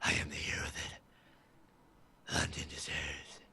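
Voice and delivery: very low whispered Batman parody. Acted. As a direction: drop way down into a hushed superhero rasp.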